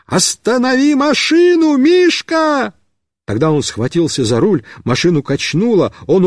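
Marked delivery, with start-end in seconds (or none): 0.1-2.7 high pleading yell; shouting. Acted. Boy circling on the car shouts to Mishka, pleading shout. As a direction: call out to stop the machine. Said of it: "закричал".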